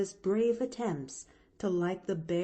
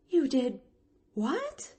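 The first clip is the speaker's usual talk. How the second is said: higher pitch leaping on the question; astonished; 7 semitones higher than usual. Acted. Nancy hears of the escape, astonished. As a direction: stop short in disbelief.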